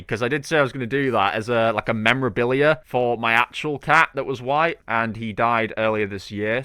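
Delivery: usual speaking voice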